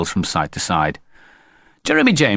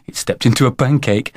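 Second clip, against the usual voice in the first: put-on light boyish giggle in the voice; tickled, slightly raised voice; giggled; 3 semitones higher than usual. A narrator's reading. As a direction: say it through a small giggle. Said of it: "giggled Jeremy James".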